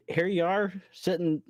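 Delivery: ordinary talk, nothing marked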